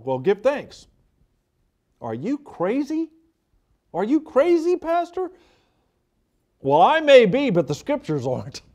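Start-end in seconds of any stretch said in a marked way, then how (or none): 2.0-5.3 pitched up high, mock disbelief; lightly voiced doubter, rising higher; incredulous doubter. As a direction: play a scoffer who can't believe it. Voicing a doubter questioning pastor.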